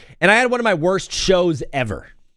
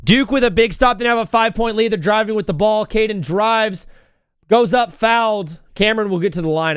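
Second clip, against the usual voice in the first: raised excited play-by-play, higher; live play-by-play; 6 semitones higher than usual. Real, not acted. Streamer calling the basketball game to chat, excited play-by-play. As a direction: call the drive as it happens.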